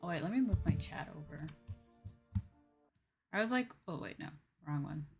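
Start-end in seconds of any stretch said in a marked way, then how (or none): none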